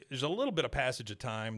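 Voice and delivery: normal talking voice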